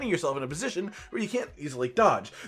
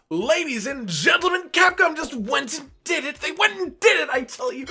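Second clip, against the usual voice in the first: high, hyped-up proclamation; excited announcer; 7 semitones higher than usual. Acted. Host telling the crowd Capcom did it, excited announcement. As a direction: announce it like astonishing news, pitched up.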